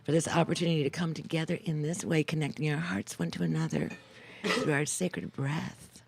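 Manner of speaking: soft, reverent closing thanks; hushed gratitude at the end of a breathing practice; guided meditation. Real, not acted. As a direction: close the meditation gently, easing down.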